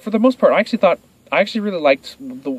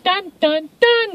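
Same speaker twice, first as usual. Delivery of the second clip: high, silly cartoon sloth; sing-song dopey creature call; much slower than usual. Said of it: "sloth that went".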